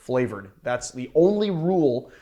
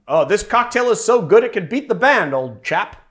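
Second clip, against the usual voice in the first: mock British accent, higher and louder; lightly hammed-up British gentleman; 7 semitones higher, 4 dB louder than usual. Host imagining an explorer praising the cocktail, mock British accent. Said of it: "They say something like".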